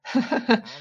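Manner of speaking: high nervous giggle; nervous laugh. Acted. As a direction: giggle anxiously. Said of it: "Nervous laughs".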